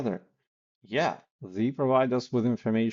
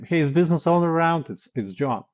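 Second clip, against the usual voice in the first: casual command; 7 semitones higher, 7 dB louder, faster than usual. Shown as a demonstration. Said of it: "Similar thing if you don't know the name".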